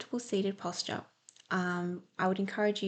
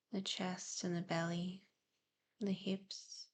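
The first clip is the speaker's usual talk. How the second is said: guided meditation; 4 dB quieter than usual. Real, not acted. Host easing listeners into breathing and present awareness, soft meditative delivery. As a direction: gently guide them into calm, leaving space to breathe.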